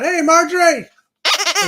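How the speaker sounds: loud, high hey to a friend; excited greeting. Real, not acted. Host welcoming Marjorie into the live chat, loud and high. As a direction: greet her like she just walked in.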